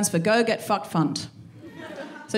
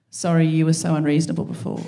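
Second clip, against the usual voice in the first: sleepy husband; 8 semitones lower than usual. Retold or imitated. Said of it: "he rolls over and goes".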